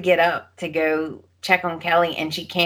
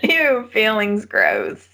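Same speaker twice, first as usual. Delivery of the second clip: mock disgust; 4 semitones higher, slower than usual.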